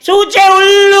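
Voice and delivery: high, loud shrill-free woman's retort; wife's voice. Retold or imitated. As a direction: answer down from the balcony high and loud. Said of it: "તા શીલું બહાર નીકળી". (પત્નીનો ઊંચો મોટો અવાજ)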